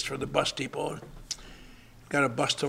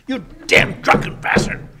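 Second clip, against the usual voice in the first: retold furious yell; 9 semitones higher, 8 dB louder, slower than usual. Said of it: "He said".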